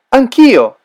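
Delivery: ordinary delivery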